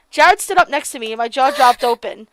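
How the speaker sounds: normal talking voice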